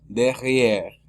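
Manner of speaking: "derrière" with no vowel after the last R; silent final E. Shown as a demonstration. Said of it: "es muda". (derrière con la E final muda)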